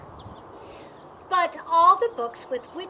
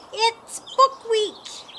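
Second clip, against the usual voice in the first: dread; 6 semitones higher, 4 dB louder, slower than usual. Acted. Girl dreading Book Week.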